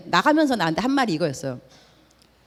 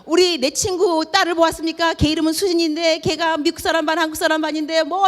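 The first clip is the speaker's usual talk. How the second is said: higher, urgent pleading friend; frantic helper; 7 semitones higher than usual. Retold or imitated. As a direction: beg strangers as if it were your own child. Friend joins the frantic search. (친구 딸을 함께 찾아 나선 다급한 친구 목소리)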